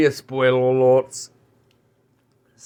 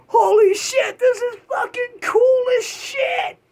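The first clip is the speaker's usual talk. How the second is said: much higher, thrilled shout; excited Andrew Garfield. Retold or imitated. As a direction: yell it like a giddy fan meeting his heroes. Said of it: "Andrew Garfield was like".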